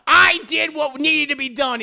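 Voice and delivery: loud, high, cold boast; defiant declaration. Acted. Dio admitting the killing to JoJo, loud and defiant. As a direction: proclaim it proudly.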